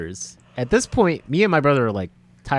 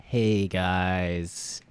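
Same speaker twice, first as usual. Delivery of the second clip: low, slow, soft announcer; deadpan announcement; 4 semitones lower, 4 dB quieter, much slower than usual. Retold or imitated. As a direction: drop the bad news flatly and slowly. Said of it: "And then they're like".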